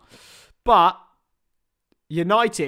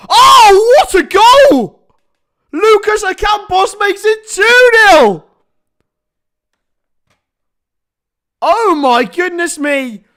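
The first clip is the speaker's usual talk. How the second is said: long goal yell; 12 semitones higher, 12 dB louder, slower than usual. Real, not acted. Goal scored, commentator erupts.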